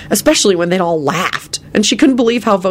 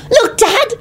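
put-on very high little boy's squeal; excited squeal; slower than usual. A narrator's reading. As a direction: squeal it to Dad, drawing it out. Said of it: "Teddy squealed excitedly".

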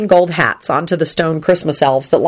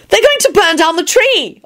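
high-pitched alarmed yell; Elizabeth, yelling; 14 semitones higher than usual. A narrator's reading. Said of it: "Elizabeth yelled".

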